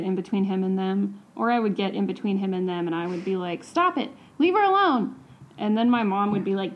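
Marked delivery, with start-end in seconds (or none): 3.6-5.1 higher-pitched retold protest; indignant, high-pitched retort, not full volume; defiant mock yell. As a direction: re-enact a brave kid standing up to an adult. Child yelling at abuser, retold.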